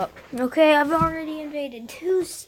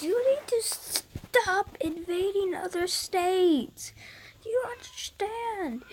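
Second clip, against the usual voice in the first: tearful plea; 7 semitones higher, 5 dB quieter than usual. Acted. State pleading with Texas to stop invading.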